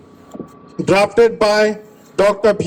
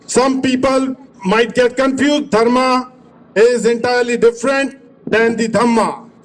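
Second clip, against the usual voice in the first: usual speaking voice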